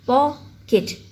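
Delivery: पॉकेट split as पॉ, केट; two separate beats in पॉकेट; syllable by syllable. Shown as a demonstration. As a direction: break पॉकेट in two with a short pause. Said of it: "more than one syllable".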